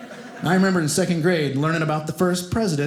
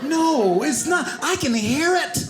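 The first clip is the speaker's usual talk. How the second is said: high-pitched, incredulous objection; indignant protest; 8 semitones higher, slower than usual. Acted. Second grader argues with teacher over silent R, high indignant protest.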